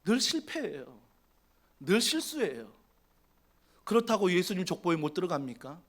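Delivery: usual speaking voice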